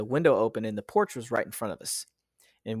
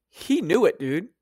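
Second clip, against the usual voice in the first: ordinary talk, nothing marked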